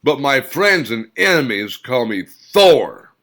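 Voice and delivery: loud defiant spit of a line; loud fierce declaration; spat words. A narrator's reading. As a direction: spit it at the crowd. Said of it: "spit the words toward the crowd".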